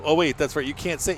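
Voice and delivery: normal talking voice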